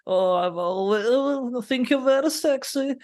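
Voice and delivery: high, slow, hammed-up seductive purr; teasing falsetto send-up of a sexy dance offer; mock seductive Valentina. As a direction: camp up a flirty woman, pitched high and drawn out. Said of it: "then she's like".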